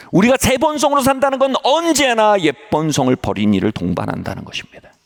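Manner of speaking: high-pitched, insistent proclamation; forceful preaching. Real, not acted. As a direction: drive the point home to the congregation, pitched high. Preaches key point forcefully. (높은 음으로 힘주어 외치는 설교)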